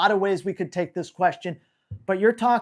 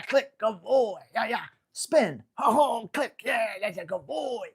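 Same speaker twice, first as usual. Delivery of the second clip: upbeat dog praise; slower than usual. Shown as a demonstration. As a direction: hype the dog up with commands and praise. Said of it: "this is what we're doing".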